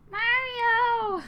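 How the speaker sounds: long, very high wailing cry of Mario; drawn-out high wail; wailing scream. Acted. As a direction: scream his name in despair. Peach screams his name.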